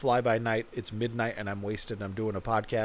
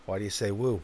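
usual speaking voice

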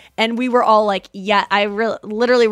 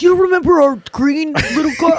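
loud, higher mock angry dad; mad dad; 8 semitones higher, 7 dB louder than usual. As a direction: play the annoyed dad, loud. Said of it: "My dad would be mad".

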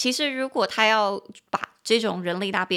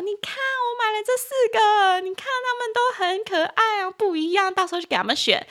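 much higher, eager colleague showing off models; excited colleague; 12 semitones higher than usual. Retold or imitated. Colleague showing off Gundam models to the speaker, high and eager. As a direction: gush over the models you bought. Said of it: "说".

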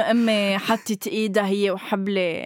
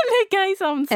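high, laughing tease; 9 semitones higher, faster than usual. Real, not acted. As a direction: points it out while laughing. Laughing at the host's shock. (بضحكة)

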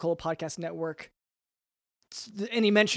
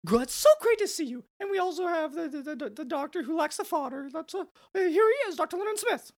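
high, chirpy koala mascot voice; cartoon mascot host; 9 semitones higher than usual. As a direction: play a cheesy corporate mascot host. Mascot character hosting.

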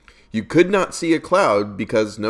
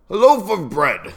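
higher theatrical walrus voice; walrus character; 8 semitones higher, 3 dB louder than usual. A narrator's reading. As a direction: announce it as a hammy walrus. Walrus speaks in a poem.

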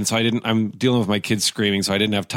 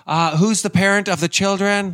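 a higher, slightly pointed office lady; lightly voiced stern receptionist; annoyed lady; 11 semitones higher, 4 dB louder than usual. Imitating the lady asking the mom, a higher stern voice.

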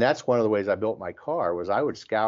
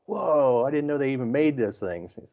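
astonished; 5 semitones higher than usual. Retold or imitated. Reacting to the guy's rare Porsche wheels, astonished. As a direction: replay your own impressed disbelief.

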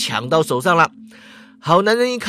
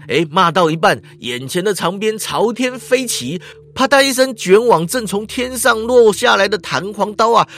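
ordinary delivery, nothing marked

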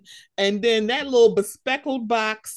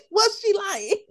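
laughing; 8 semitones higher than usual. Retold or imitated. Laughing through repeated question.

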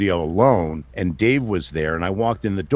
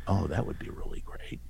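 very soft, near-whispered musing; barely voiced private thought; hushed inner thought; 13 dB quieter than usual. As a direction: think it to yourself under your breath. His hopeful inner thought.

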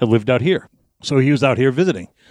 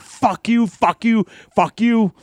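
high, repeated mock-angry retort; re-enacted yelled cursing; imitated yelling; 9 semitones higher, much slower than usual. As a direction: reject the apology in a raised, high pitch. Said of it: "still yelling".

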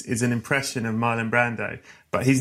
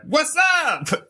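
a newborn with swagger; brash American greeting. Retold or imitated. Mocking Americans as a confident newborn, high brash greeting. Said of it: "They come out the womb like".

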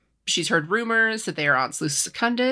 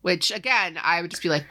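ordinary delivery

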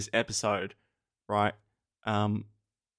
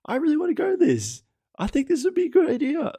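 much higher squeaky voice for an excited past self; mock eager younger self. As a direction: squeak it out like a keen newbie. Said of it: "I'm like".

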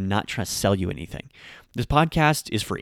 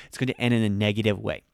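usual speaking voice

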